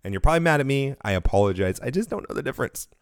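ordinary talk

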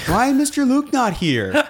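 put-on high, loud little-kid complaint; whiny child. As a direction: wail it like a disappointed child. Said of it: "every child walks up to me and asks".

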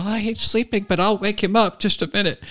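put-on high, eager little boy; lightly high child voice; childlike voice. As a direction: play a small child helpfully offering to fetch dad. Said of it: "he answered the phone like a child".

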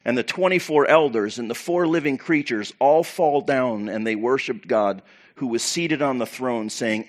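usual speaking voice

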